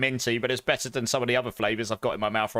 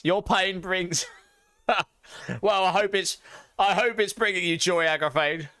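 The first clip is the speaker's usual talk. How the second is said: laughing; 5 semitones higher than usual. Real, not acted. Reading chat, laughing.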